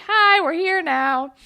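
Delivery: high, loud, drawn-out hello; mock chirpy greeting. Child services arriving right on cue, mock chirpy. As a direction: imitate officials announcing themselves too eagerly.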